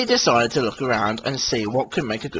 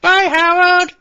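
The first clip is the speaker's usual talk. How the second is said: high, loud goodbye call; high, louder farewell; calling Billy; 7 dB louder than usual. Acted. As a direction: call out over the track. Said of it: "called Billy".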